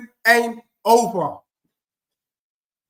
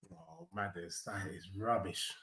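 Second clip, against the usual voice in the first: much softer, lower aside; muttered aside; 23 dB quieter than usual. Real, not acted. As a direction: grumble it under your breath. Quiet aside.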